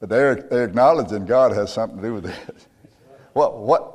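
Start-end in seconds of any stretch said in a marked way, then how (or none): none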